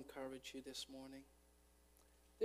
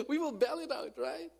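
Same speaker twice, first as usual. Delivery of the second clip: laughing; 12 semitones higher, 12 dB louder than usual. Real, not acted. Laughing repeat of quip.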